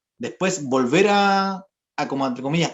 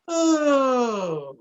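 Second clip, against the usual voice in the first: descending glissando, fading; 7 semitones higher than usual. Shown as a demonstration. Teacher showing a glide with falling volume, fading. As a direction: glide down and let it fade. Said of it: "pero bajando la intensidad".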